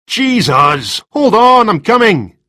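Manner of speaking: loud, high call through a closed door; calling out from inside. Acted. Man yelling to Rebus at the door, loud and high.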